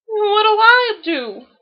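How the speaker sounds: high, slow piping plea; a small voice near tears. A narrator's reading. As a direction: Roo distressed about the valentine. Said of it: "piped Roo".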